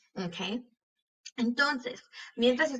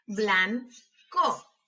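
"Blanco" slowly as blan, pause, co; broken into syllables. Shown as a demonstration. Teacher helping hear each sound, syllable by syllable. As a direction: break "Blanco" in two. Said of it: "quiero que las quiebres entre sílabas".

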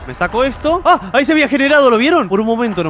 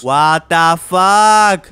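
stunned drawl; 6 dB louder, much slower than usual. Real, not acted. Stunned by the creepy face.

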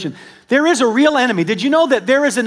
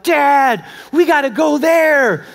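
put-on high, loud eager child voice begging; excited kids; 7 semitones higher, 4 dB louder, slower than usual. Kids begging dad to go there, loud eager child voice.

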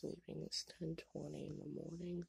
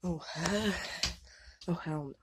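disgusted; 3 semitones higher, 6 dB louder than usual. Real, not acted. Disgusted by smell.

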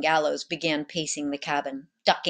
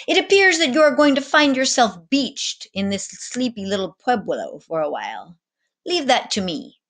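lofty, theatrical captain's voice, pitched up; grand sea captain; 7 semitones higher, 4 dB louder than usual. A narrator's reading. Captain grandly addressing the cabin boy, theatrical voice. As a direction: play a showy ship's captain holding court.

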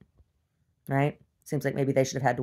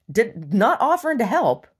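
indignant; 7 semitones higher, 6 dB louder than usual. Real, not acted. Indignant complaint.

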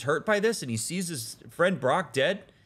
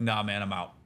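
lower, softer, unbothered shrug of a voice; laid-back dismissal; 5 semitones lower, 3 dB quieter than usual. Retold or imitated. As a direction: shrug off the prophecy, lazy and calm. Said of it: "they're like".

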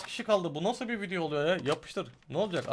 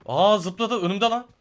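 surprised; 6 semitones higher, 8 dB louder, slower than usual. Real, not acted. Enemy jumps right in front.

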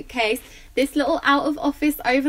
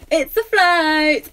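drawn-out, louder, higher announcement; excited reveal; 5 semitones higher, 6 dB louder, much slower than usual. Real, not acted. Vlogger unveils her favourite buy to viewers, excited and drawn out. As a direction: sing out the big reveal, stretching it.

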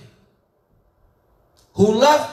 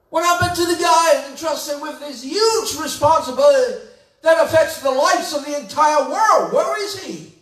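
preaching at full force; 7 semitones higher than usual. Real, not acted. Preacher asking the congregation where the rock went, shouting. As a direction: thunder the questions at the congregation.

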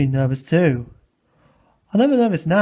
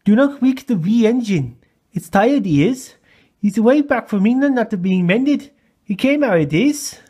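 lilting Scottish engine voice; Scottish accent; 5 semitones higher, 3 dB louder than usual. Acted. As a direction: play a fussy Scottish engine.

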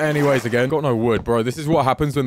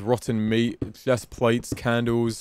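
ordinary talk, nothing marked

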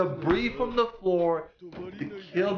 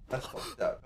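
whispered; 7 semitones lower, 10 dB quieter than usual. Real, not acted. Shocked reaction repeated hushed.

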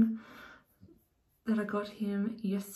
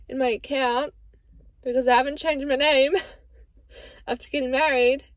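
louder, laughing through the words; laughing at herself; 3 semitones higher, 6 dB louder than usual. Real, not acted. Laughing at her own bank blunder to viewers, louder and amused. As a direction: giggle through the confession.

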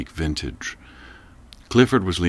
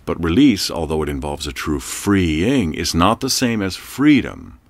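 ordinary delivery, nothing marked

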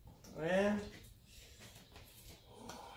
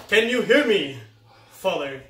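loud; 14 dB louder than usual. Pretend. Loud dramatic line.

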